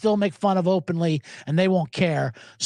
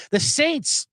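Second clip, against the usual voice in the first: normal talking voice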